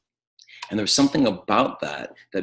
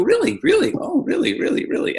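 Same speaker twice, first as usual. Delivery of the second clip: intrigued listener. Shown as a demonstration. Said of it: "listen and go".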